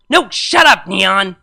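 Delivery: high, loud snap at a friend; angry snap. Acted. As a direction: snap at them to shut up. Snapping at friend.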